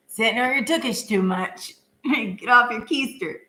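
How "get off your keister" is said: ordinary delivery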